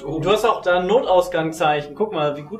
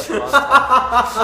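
laughing; 7 semitones higher, 7 dB louder than usual. Real, not acted. Amused after a shared memory.

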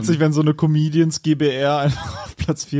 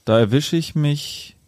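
poem recital; 2 semitones lower than usual. Acted. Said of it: "das Abschlussgedicht, äh, vortragen".

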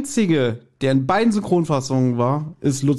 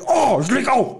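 quoting the caller; 9 semitones higher than usual. Retold or imitated. Caller hangs up in panic.

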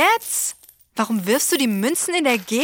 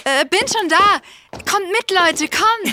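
excited; 8 semitones higher, 4 dB louder than usual. Acted. Excited calling along.

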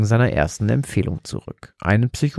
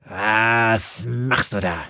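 slow, inhuman demonic growl; much slower than usual. Acted. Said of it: "knurrte sie dem Pfarrer an".

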